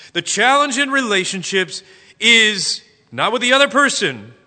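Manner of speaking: loud, high, driving sermon delivery; full-force preaching. Acted.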